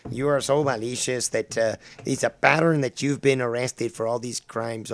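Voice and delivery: loud, hammed-up Spanish-accented accusation; mock Spanish accent. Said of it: "doing the Spanish accent for Seaman".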